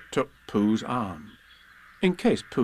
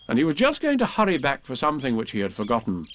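usual speaking voice

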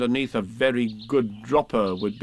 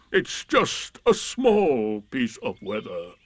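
very slow, drawn-out doleful voice; gloomy Eeyore; 4 semitones higher, much slower than usual. Acted. Eeyore forecasting bad weather, slow and gloomy. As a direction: drag it out like a glum donkey.

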